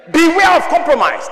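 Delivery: loud, high burst of warning; preacher's warning. Real, not acted. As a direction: cry it out to the congregation. Sudden preacher's warning cry.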